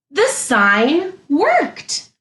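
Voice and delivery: thrilled it worked; excited gasp. Shown as a demonstration. Teacher to class, thrilled the sign worked. Delighted discovery.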